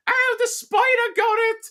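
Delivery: very high falsetto British mum voice; mock British mum. Mum answering her son, high falsetto mock British accent. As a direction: squeak it like a silly British mother. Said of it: "uh, British all of a sudden".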